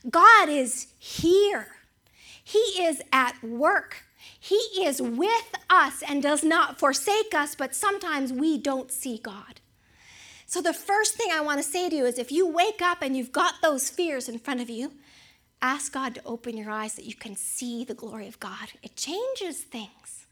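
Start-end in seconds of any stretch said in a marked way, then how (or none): 0.1-7.5 very slow, weighty declaration; slow emphatic assurance. Real, not acted. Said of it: "preacher slows to stress truth". 15.6-20.3 much softer, intimate plea; soft sincere appeal. Real, not acted. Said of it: "closing appeal to congregation".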